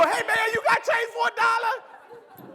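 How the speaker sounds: Joey at the urinal asking for change, high and eager. Pretend. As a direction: ask it high and drawn out, overeager. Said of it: "I can just see Joey go".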